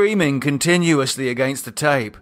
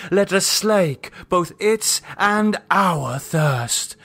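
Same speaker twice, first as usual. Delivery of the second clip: cult leader Lydia; 6 semitones higher than usual. Acted. Cult leader addressing the circle, solemn and raised. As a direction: intone it like a high priestess. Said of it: "said Lydia".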